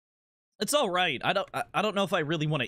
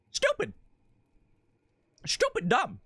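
squeaky self-scold; 13 semitones higher than usual. Real, not acted. Scolding himself over sold coin.

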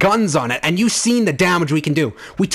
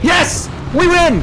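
high, excited victory cry; elated shout, voice pitched up; triumphant cheer; 8 semitones higher, slower than usual. Real, not acted. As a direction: celebrate a hard-won win. Believes the boss is beaten.

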